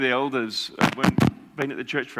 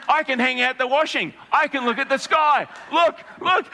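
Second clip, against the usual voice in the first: much higher, louder, eager; excited old lady; 10 semitones higher, 5 dB louder than usual. Retold or imitated. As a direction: show off the healed neck with glee. Elderly woman showing healed neck.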